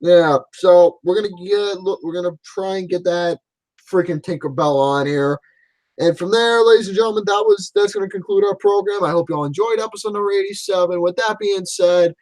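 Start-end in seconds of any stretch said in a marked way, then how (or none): 6.0-12.1 announcer-style sign-off to the listeners; show sign-off. Acted. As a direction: wrap up the show like a host. Signs off the show.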